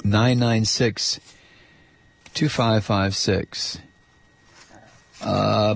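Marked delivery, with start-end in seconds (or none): 0.0-3.8 slow number read. Shown as a demonstration. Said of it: "The phone number here is".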